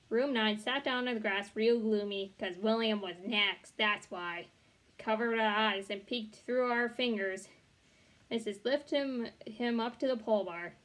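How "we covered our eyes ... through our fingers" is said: ordinary delivery, nothing marked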